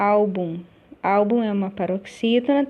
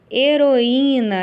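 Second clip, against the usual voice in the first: louder and higher, drawn out slowly; slow emphatic dictation; 4 semitones higher, 6 dB louder, much slower than usual. Shown as a demonstration. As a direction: announce the word slowly and clearly to the class. Teacher reads exercise word aloud. (palavra anunciada devagar, mais alto e mais agudo)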